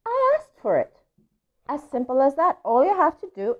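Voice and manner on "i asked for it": very high, innocent little duckling; tiny duckling voice. Acted. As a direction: answer the pigeon sweetly. Duckling answers pigeon.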